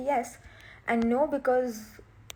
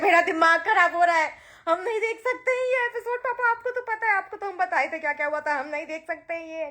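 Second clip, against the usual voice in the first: higher, whiny panic to her father; retelling her panicked call to dad, higher and whiny; frantic daughter; 3 semitones higher, faster than usual. Retold or imitated. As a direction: plead like a mortified kid on the phone. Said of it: "I was like".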